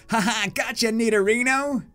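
put-on high gleeful boy; triumphant kid. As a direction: crow it like a boy who won. Voicing Red catching.

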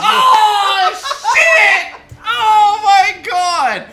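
loud, high excited yell; excited shout. Real, not acted. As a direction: shout in delighted shock. Reacting to game moment.

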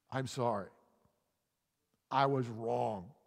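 measured apology. Shown as a demonstration. Models an apology for listeners.

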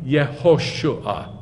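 slow "Yehoshua", syllable by syllable; book vowel. Shown as a demonstration. Teacher telling class to look at the name, slow separated "Yehoshua". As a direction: sound out "Yehoshua" as yeh-ho-shu-ah. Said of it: "it makes that sound".

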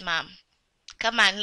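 usual speaking voice

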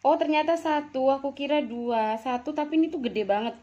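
loud, high surprised discovery. Real, not acted. Finds one big fish head. (terkejut senang, suara tinggi dan keras)

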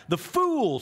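high, loud scornful cry of a witch; cried out. A narrator's reading. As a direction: shriek it in triumph. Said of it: "she cried".

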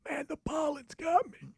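high, soft, strained pollen voice; hoarse allergy-stricken dad. Retold or imitated. Parent at the game explaining his lost voice, in a strained high soft voice. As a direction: squeeze it out like your throat is shot. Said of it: "And he's like".

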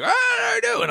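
put-on squeaky, high old man's greeting. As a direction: pipe it up like an awkward old man. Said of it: "Old man's looking at me like".

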